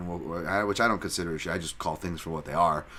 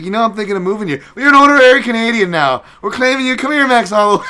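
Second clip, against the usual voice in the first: much higher, shouted, fast hammed-up Canadian accent; mock Canadian claiming; 14 dB louder, faster than usual. Mocking Canadians claiming Max Holloway, high fast shouted Canadian accent. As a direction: yell it like an eager Canadian adopting a tourist, high and fast. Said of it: "Canada comes in first is just claiming anybody".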